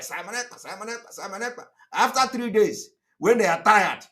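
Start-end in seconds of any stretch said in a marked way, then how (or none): 0.0-1.6 softly mimicked chant of the name, each one lifting at the end; mock frantic ranting. Speaker mimicking rivals going mad everywhere, soft mock ranting. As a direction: imitate their ranting in a soft, repetitive murmur. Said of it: "They will be going mad everywhere".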